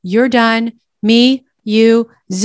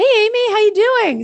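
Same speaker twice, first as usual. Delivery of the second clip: bright greeting; 11 semitones higher than usual. Real, not acted. Greeting a viewer on live.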